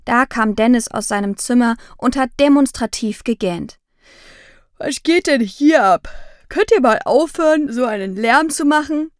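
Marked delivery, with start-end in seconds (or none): 4.8-9.1 put-on higher, louder annoyed teen voice; a sleepy brother complaining about the noise; grumpy brother. Big brother wakes up and complains to the family, grumpy and loud.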